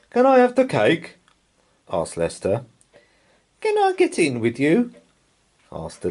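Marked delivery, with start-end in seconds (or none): 0.1-1.2 put-on high eager child asking; child's voice. A narrator's reading. Laura asking mum for the paper, high child voice. 3.6-4.9 put-on high eager child asking; child's voice. A narrator's reading. Laura asking mum for the paper, high child voice. Little one asks mum.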